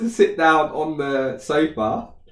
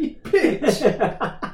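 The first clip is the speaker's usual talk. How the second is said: laughing mock insult; 12 semitones higher than usual. Thinking while she giggles.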